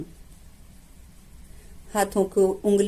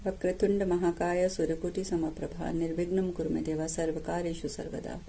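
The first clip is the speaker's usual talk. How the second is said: Sanskrit mantra recitation; 8 dB quieter than usual. Real, not acted. Meditation guide invoking Ganpati before practice, hushed mantra recitation. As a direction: recite the mantra softly, letting it die away.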